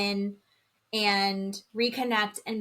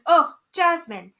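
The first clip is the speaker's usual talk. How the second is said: loud, drawn-out dismay, pitched up; exasperated reaction; 6 semitones higher, 9 dB louder, slower than usual. Retold or imitated. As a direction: groan the name in exasperation. Retold exasperated reaction to Jasmine.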